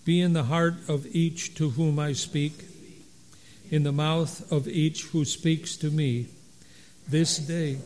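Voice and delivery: loud, steady liturgical recitation; unison recitation. Acted. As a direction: recite the canticle together, measured. Resumes canticle recitation.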